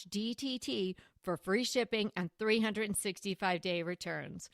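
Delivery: soft, even sponsor read. Acted. Host reading a sponsor ad to listeners, measured ad read. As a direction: read the sponsor copy softly and smoothly.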